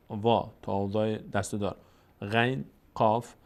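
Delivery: ordinary delivery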